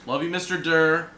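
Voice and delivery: a big cheerful shout-out; loud shout-out. Real, not acted. Friend shouting out his old shop teacher, loud and high. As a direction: call out "Love you, Mister Durr" loud and high.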